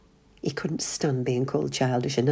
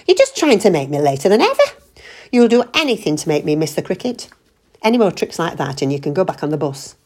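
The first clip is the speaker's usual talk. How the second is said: louder, faster angry outburst; exploding in anger; 3 semitones higher, 7 dB louder, faster than usual. A narrator's reading. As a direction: let the anger burst out. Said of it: "John exploded".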